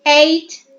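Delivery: teacher models "eight" for the class; diphthong demo. Shown as a demonstration. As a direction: punch out the glide in "eight". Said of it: "Coba misalnya A".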